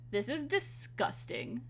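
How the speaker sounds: usual speaking voice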